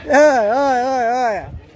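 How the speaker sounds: loud, high repeated call; excited calling. Real, not acted.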